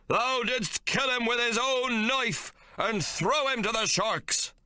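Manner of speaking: high, slow, preaching captain voice; a zealot captain condemning Hawke; thundering captain. A narrator's reading. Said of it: "continues to thunder at Hawke".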